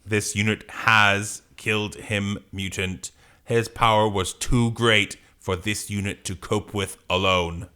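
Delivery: measured, emotionless machine; robot voice. Acted. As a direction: report the death without any feeling. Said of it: "Are you gonna do a robot voice?".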